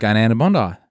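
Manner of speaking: slurred Aussie Going down to Bondi; Australian accent. Shown as a demonstration. Australian guest demonstrating his native accent. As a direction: run the words together like a local. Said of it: "slur all the words closer together".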